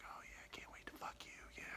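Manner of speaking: hushed, whispered mock seduction; barely audible put-on whisper; whispered dirty talk.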